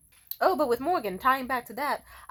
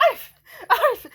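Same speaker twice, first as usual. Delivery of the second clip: high, loud yappy bark; imitated dog bark; 14 semitones higher, 9 dB louder than usual. Imitating a grown man made to bark, high yap. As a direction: yelp like a little dog. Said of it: "And then he'd be like".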